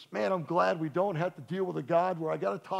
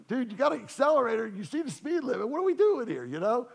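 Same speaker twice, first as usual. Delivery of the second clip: raised, higher pitched exasperation at a dawdling driver; 7 semitones higher, 4 dB louder than usual. Retold or imitated. Pastor mock-scolding a twenty-mph driver, exasperated and raised. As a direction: throw up your hands at the car ahead, louder.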